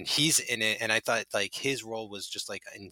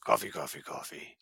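mock Pacino; 4 semitones lower than usual. Mimics Pacino's coffee ad.

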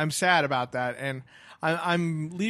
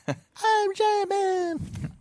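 very high, very slow squeaky giant; silly giant voice; much slower than usual. Pretend. Joking giant man voice.

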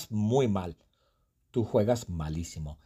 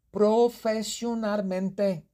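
proud boast; 12 semitones higher, 3 dB louder, slower than usual. Acted. Juana boasts about her golf.

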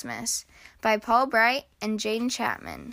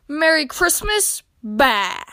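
louder, mocking echo of the greeting; grumpy shout back; 4 semitones higher, 5 dB louder, slower than usual. A narrator's reading. Grumpy Badger rejects Squirrel's Christmas greeting, shouting back. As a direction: throw it back scornfully, dropping onto a curt Bah. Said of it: "he shouted back".

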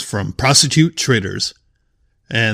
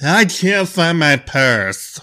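slow, higher mocking drunk girl voice; 6 semitones higher, much slower than usual. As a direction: drag it out like a drunk girl begging for help. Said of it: "some drunk girl being like".

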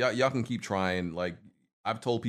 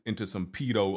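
ordinary delivery

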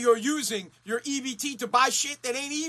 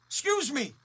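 mock confrontation; 4 semitones higher, 6 dB louder, slower than usual. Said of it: "I wanna say".

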